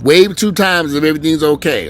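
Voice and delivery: loud call over a car loudspeaker; over the patrol car speaker. Pretend. Officer in his patrol car calls out over the speaker, loud. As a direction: boom it out through the car speaker. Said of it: "on the loud, on the speaker".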